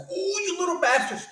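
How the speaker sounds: high squeaky mock exasperation; exasperated outburst. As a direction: cry out at the tiny offer. Annoyed at a two dollar delivery.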